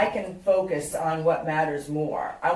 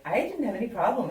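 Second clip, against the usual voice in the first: quoted in a higher voice; lightly acted, pitched-up dismissal of a worry; breezy reassurance; 6 semitones higher, faster than usual. Woman telling her matchmaker the date's accent was fine, breezily.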